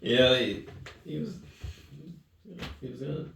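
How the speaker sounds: high, strained voice on the edge of tears; fighting back tears; voice breaking. Real, not acted. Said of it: "it kills me every time".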